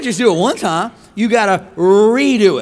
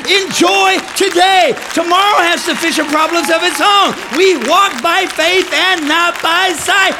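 preaching at full force; 8 semitones higher, 5 dB louder than usual. Acted. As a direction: preach it to the rafters.

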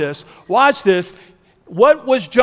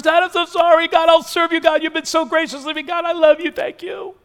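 high-pitched, hurried mock prayer; mock gushing repentance; 8 semitones higher than usual. Preacher playing Jonah repenting to God, high and gushing. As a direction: gush apologies in a high put-on voice.

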